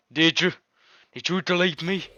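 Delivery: shocked heroine. Acted. The betrayed game girl asking the player in disbelief. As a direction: ask it stunned and hurt.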